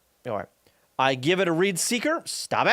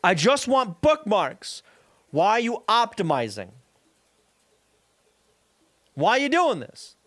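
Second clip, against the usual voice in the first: exasperated; 4 semitones higher than usual. Real, not acted. Ranting about a bloated library.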